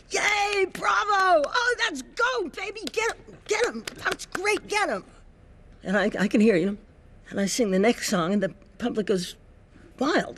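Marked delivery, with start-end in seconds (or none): 0.1-5.0 high, excited cheering from the wings; mother cheering from the wings. Retold or imitated. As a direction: shout it up high like a proud mom backstage. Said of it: "she's going".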